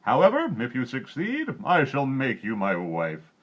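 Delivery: high, loud king's threat and promise; a greedy king to the miller's daughter; king's bargain. A narrator's reading.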